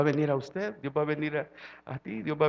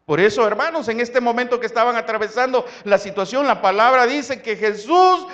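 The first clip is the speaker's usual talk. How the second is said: loud, driving sermon voice, much louder; full-force preaching; 7 semitones higher, 10 dB louder than usual. Acted. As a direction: preach it out to the congregation.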